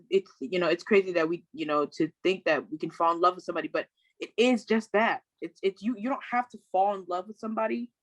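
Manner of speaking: ordinary talk, nothing marked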